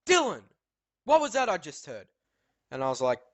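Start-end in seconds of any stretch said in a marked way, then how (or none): 0.1-2.1 high, sharp call of a name, then a louder demand; the teacher catching a pupil out, mock stern; mock stern teacher demanding an answer. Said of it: "She said".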